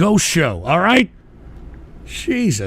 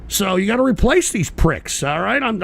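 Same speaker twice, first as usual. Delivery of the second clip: ordinary talk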